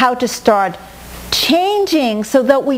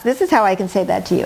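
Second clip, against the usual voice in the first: ordinary talk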